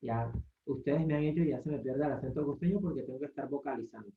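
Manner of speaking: usual speaking voice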